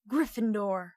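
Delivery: lightly called-out house name; mild shout. A narrator's reading. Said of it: "the hat had shouted".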